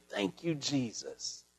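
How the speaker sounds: awed thanks. Shown as a demonstration. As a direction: breathe it out slowly, overwhelmed. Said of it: "you can't do nothing but sit there and say".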